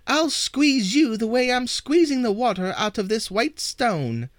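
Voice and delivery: high, loud shriek. Acted. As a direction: shriek the threat at the troll. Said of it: "he shrieked to the troll".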